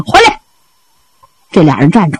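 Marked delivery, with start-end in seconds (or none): 0.1-0.4 short high-pitched call; calling out loudly. A narrator's reading. Jiangzhong calls back two running servants, high shout. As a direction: call them back sharply in a raised voice. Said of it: "江中高声喊上".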